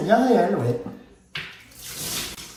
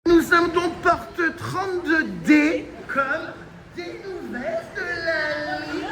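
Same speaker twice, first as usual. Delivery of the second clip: mock announcer; 8 semitones higher, slower than usual. Announcement to camera.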